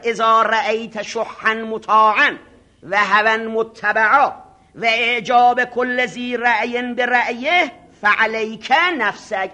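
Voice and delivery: slow, solemn Arabic recitation; hadith recitation. Acted. Preacher reciting the Prophet's words to the seminary, slow and solemn. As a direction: intone the hadith slowly.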